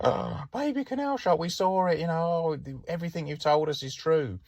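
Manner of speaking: put-on very high, squealing child's amazement; thrilled kid voice. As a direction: squeal it like a kid whose wildest story just came true. Kids believe the shark story.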